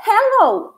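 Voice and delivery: hello said clearly as a model word. Shown as a demonstration. Teacher models hello for students. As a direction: present hello to the class. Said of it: "a gente diz".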